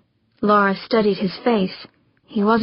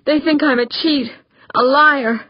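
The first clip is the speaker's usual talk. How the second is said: raised, anguished outburst; higher-pitched, wounded disbelief at being accused; distraught; 5 semitones higher than usual. A narrator's reading. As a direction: cry out as the wrongly accused. Distraught husband confiding, accused of cheating.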